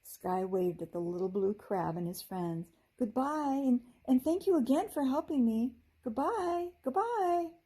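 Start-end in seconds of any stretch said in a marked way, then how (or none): 3.0-7.5 calling goodbye. Acted. Fairy waving farewell to crabs.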